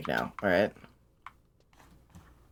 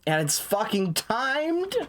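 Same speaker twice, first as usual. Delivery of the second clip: slow, high incredulous complaint; drawn out in disbelief; exasperated whine; 8 semitones higher, slower than usual. Real, not acted. Dreads timed stealth mission.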